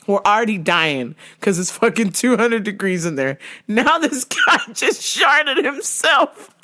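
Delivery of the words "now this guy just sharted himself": slow, laughing through it; cracking up mid-sentence. Real, not acted. Laughing while telling.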